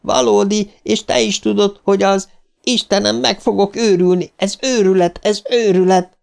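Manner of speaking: high, frantic near-scream; losing his mind with fear; hysterical panic. A narrator's reading. Stan breaks down in front of his friends, hysterical panic.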